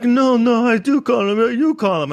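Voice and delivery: shrill-sounding insistence, mocking her; imitating the woman. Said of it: "she's like".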